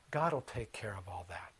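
hushed, confiding whisper. Acted. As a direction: breathe it as a secret trust. Jesus' inner trust voiced.

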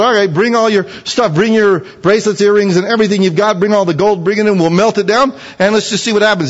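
high, casual command; breezy, let's-see-what-happens; voicing Aaron. Aaron telling the people to bring gold, high and casual.

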